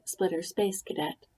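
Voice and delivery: ordinary delivery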